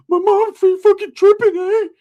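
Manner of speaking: put-on high, loud whimpering teen voice; crying cousin voice. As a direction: wail it like a scared kid cousin. Crying cousin Ricky talking.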